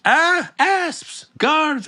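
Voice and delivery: very high, shrill mock panic; shrieking queen. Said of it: "And she goes".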